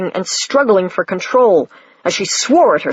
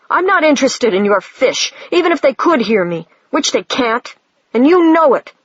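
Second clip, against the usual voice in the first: angry; 6 semitones higher than usual. A narrator's reading. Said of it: "she sang angrily".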